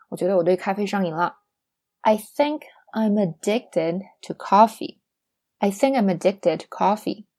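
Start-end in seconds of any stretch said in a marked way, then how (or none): 2.0-5.0 slow, word-by-word teaching delivery; slow model sentence. Shown as a demonstration. Teacher models a coffee example for learners, slow and deliberate. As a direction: spell it out slowly, word by word.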